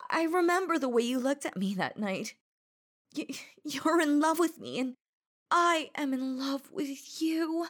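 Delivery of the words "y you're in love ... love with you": pleading declaration. Acted. Desperate declaration of love.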